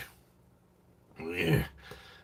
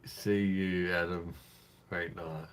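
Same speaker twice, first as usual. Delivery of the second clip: ordinary delivery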